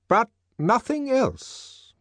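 slow, high-pitched director's voice; director's reply. Acted. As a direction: answer the student slowly, pitched high.

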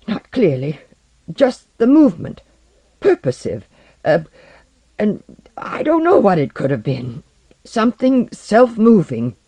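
shaken account. Acted. Porlock struggles to say what he saw, badly shaken. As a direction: grope for words, still alarmed. Frightened man struggles to describe sighting.

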